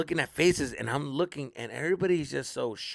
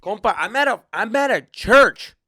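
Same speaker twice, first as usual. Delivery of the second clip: stunned realization; 5 semitones higher, 10 dB louder than usual. Retold or imitated. Comic at church gig, realizing the venue, aghast. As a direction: drag out the horror, louder.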